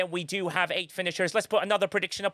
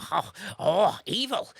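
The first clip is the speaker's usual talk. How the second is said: ordinary delivery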